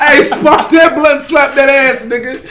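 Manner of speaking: high, loud, slow hype yell; hyped-up yelling along to a favourite verse; hype shout-along. Retold or imitated. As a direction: shout it out like you're hyping the car. Hyping along to the song.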